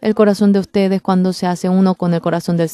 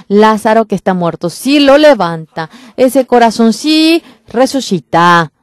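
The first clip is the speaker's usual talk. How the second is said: louder and higher, slowing at the end; preaching the raising of Lazarus, louder and higher; rising preaching; 4 semitones higher, 5 dB louder than usual. Real, not acted. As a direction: build to a triumphant cry. Preacher climaxes on the resurrection.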